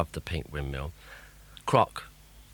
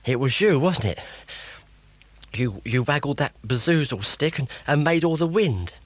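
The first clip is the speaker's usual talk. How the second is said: put-on boy voice; 6 semitones higher, 4 dB louder than usual. Rod accusing Grotbags.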